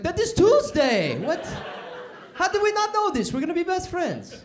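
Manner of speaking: shrieking high and loud with delight. Acted. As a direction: squeal like you found your new best friend. Redneck thrilled to find a friend.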